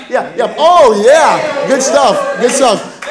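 loud, drawn-out cheer; boisterous gym-buddy encouragement; hyping him up. Real, not acted. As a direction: cheer on the lifter with a big shout. Cheering on man curling weights.

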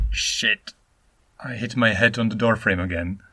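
"Shit" hissed out under the breath after bumping your head; pained shit. Shown as a demonstration. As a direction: breathe "Shit" out in pain, then grumble on. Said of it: "Or you could say".

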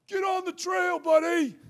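high, strained mock yell; aggressive yell. Jeep driver yelling at a cyclist across the road, aggressive high shout. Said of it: "he yells ... very, like, aggressive and kind of violent".

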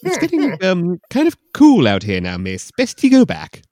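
loud, high mock Scottish brogue; Scottish man. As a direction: ham up the Scottish watchman. Host voices Scottish man in novel.

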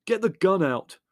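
high, loud, angry order. A narrator's reading. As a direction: order it out angrily. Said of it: "said Leslie angrily".

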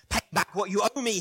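forceful creditor's order; demanding. A narrator's reading. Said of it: "he demanded".

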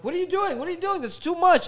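mock-fretting mother stopping the server; mom's shrill scolding. Mom scolding the waitress.

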